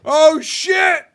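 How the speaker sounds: sudden high startled shout; fluttering cries for help. Real, not acted. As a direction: yelp out in real shock. Reacting to on-screen shock.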